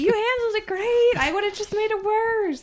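high-pitched pretend excuse; mock reassurance. Bystander to friend who nearly got shot, mock-reassuring. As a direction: excuse yourself in a put-on high voice. Host voices comic's sheepish reply.

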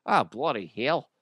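high, loud Australian firefighter; hammed-up Aussie tradie accent; Australian bloke shouting at the patient; mock Australian accent. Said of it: "they're in Australia".

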